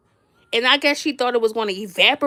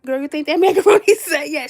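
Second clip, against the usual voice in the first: louder, higher nagging ask with a laugh; impatient nagging; 4 semitones higher, 5 dB louder than usual. Retold or imitated. Hungry guest pressing the host, loud and impatient. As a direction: push her one more time, fed up.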